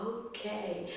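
muted doubtful okay; unconvinced okay. Retold or imitated. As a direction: humor him quietly. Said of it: "And I was like".

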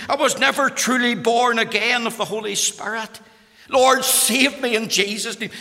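loud, high, impassioned plea to God; fervent cry of repentance, preached out; crying out in prayer. Retold or imitated. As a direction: act out a desperate confession at full voice. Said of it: "she cried out".

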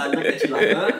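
breathless laugh, high and loud. Real, not acted. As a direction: squeeze the words out through laughter. Laughing about [name] joke.

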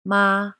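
"Ma" high, loud and level; first tone. Shown as a demonstration. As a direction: hold "Ma" on one high note. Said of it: "a flat note".

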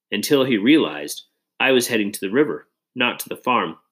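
ordinary delivery, nothing marked

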